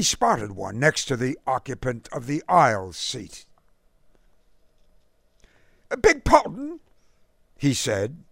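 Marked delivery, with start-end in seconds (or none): 5.9-6.8 put-on high-pitched, lofty English gent's accent; lofty, clipped British accent. A narrator's reading. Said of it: "in his lofty, clipped accent".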